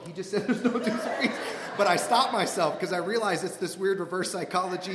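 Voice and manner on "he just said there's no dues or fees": high-pitched, laughing objection; mock urgent protest. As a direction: plead it up high to the guy beside you. Imagined protest to neighbour.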